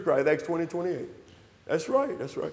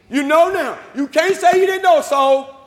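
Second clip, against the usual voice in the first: raised, emphatic pulpit warning; forceful preaching warning; 7 semitones higher than usual. Acted. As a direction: drive the warning home in a higher voice. Returns to forceful preaching, warns listeners.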